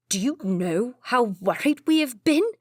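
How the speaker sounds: loud angry brother; yelled. A narrator's reading. Brother scolding the runaway, yelling. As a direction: scold him at full voice. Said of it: "Edward yelled".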